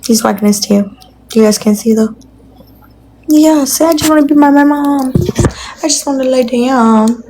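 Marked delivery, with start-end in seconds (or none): 3.3-7.2 higher, whiny puppy voice; voicing the dog. For the dog who wants his mom, a pleading puppy voice. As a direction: speak for the dog like a needy pup.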